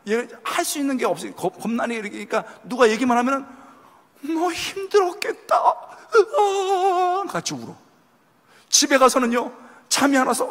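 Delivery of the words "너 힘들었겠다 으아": much higher, slow pitying cry; mock wailing. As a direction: cry along in sympathy. Sympathizer bursts into tears. (같이 울어주는 흉내)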